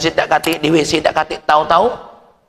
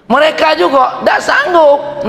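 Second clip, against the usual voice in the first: slow, loud, higher preaching burst; loud slow sermon emphasis; preaching shout; 12 semitones higher, 5 dB louder, slower than usual. Acted. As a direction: hammer the point to the congregation. Preacher drives home point.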